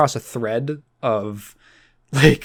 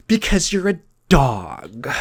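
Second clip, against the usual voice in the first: slow, drawn-out mock taunt; mock bullying; slower than usual. Girl leaning towards Sherman, mildly acted bullying taunt. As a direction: lean on DOG, imitating a bully. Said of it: "leaning towards him and going".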